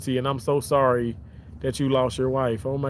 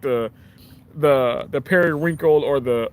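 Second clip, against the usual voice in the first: normal talking voice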